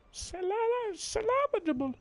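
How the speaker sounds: very high "salvageable" with the stress moved to "LA"; weird pronunciation. Acted. Player fooling with a word from the note, weird pronunciation of "salvageable". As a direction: say "salvageable" oddly as sa-LA-va-ja-ble, high-pitched. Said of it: "pronounces everything weird".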